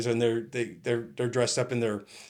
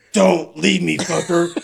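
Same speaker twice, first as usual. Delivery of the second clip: loud, slightly raised plea; retold demand; 4 semitones higher, 7 dB louder, slower than usual. Stuck colleague to laughing officer, loud indignant demand. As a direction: imitate an indignant stranded colleague.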